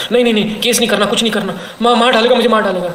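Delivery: hammed-up scared pleading; mock panicked plea. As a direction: act out a frightened girl begging. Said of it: "तो डर के कहती".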